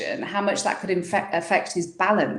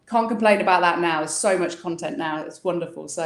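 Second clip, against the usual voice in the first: normal talking voice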